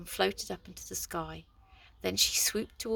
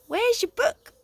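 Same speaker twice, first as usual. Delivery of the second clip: wrong voice again; 10 dB louder, slower than usual. A narrator's reading. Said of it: "No, sorry, that's the wrong one".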